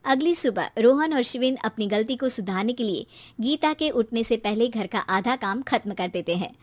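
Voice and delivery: usual speaking voice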